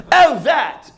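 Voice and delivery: airy mock British accent with a chuckle; put-on British accent. Driver explaining his accent to passenger, airy affected British. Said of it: "I guess I just picked up this accent".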